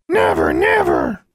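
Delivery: very high, slow shrieking bird cry; raven squawk. A narrator's reading. The raven Charlie refuses to stay, screeching. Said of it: "Charlie said".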